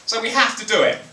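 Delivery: high, self-important American voice. Retold or imitated. America justifying spying to Europe, high and smug. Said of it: "Americans are like".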